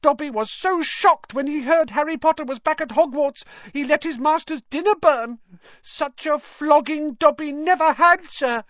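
high, loud squeaky elf; agitated high-pitched little creature; distressed house-elf. A narrator's reading.

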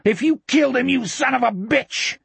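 high-pitched enraged outburst; furious cry. A narrator's reading. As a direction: scream the threat in helpless fury. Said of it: "furious eyes".

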